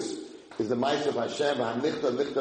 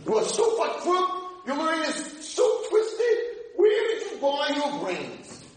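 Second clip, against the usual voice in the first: mock insult yelling; much slower than usual. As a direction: hurl them at your partner. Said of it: "We used to yell at each other".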